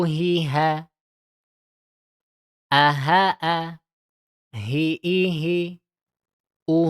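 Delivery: short, quick vowels and ha syllables, each clipped; short tajwid drill syllables. Shown as a demonstration. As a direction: read the drill crisply, no lengthening. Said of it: "dibacakan dia cepat saja".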